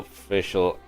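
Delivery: ordinary talk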